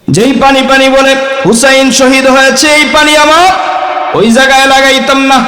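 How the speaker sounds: high mock pious voice; mock the pir's logic; pir's excuse. Preacher repeats the pir's excuse mockingly, high-pitched. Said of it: "বুঝেন নাই মনে হয়".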